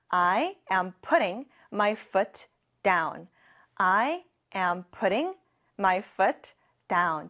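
"I put my bag down" said word by word; very slow model. Shown as a demonstration. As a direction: model the sentence slowly for repeating. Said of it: "같이 해볼까요". (따라 하도록 아주 천천히)

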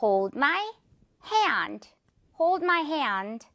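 "Hold my hand" slowly, hand stretched to "he-and"; careful demo with long A; slow model sentence. Shown as a demonstration. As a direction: model the phrase for learners, pulling the A in hand. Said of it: "이렇게 말을 한다는 거".

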